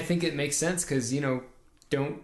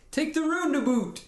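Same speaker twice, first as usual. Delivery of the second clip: much higher, put-on Western Canadian accent; a chirpy Western Canadian voice, pitched way up; 13 semitones higher than usual. Shown as a demonstration. Podcaster demonstrating the Western Canadian accent he can do. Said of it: "I can do more Western Canadian".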